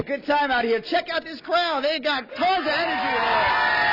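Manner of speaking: high, excited call above the noise; calling over a crowd. Real, not acted. Reporter at a morning crowd calls out excitedly. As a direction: pump up the crowd.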